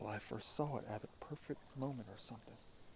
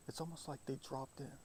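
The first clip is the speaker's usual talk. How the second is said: ordinary talk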